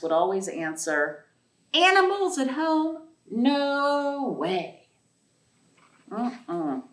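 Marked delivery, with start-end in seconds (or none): 1.7-6.8 higher, emphatic parental refusal; lightly voiced parents saying no; firm parents.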